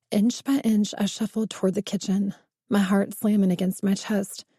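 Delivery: usual speaking voice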